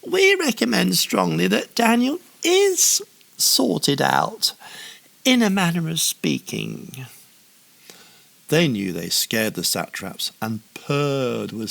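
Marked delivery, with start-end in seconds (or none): 0.0-7.1 high, sneering snarl. A narrator's reading. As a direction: snarl it like a scheming official. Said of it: "snarled the other administrator".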